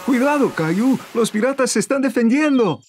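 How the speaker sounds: high-pitched, urgent warning; playful alarmed warning. Pretend. Parent warns child of pirates fighting back, high mock alarm.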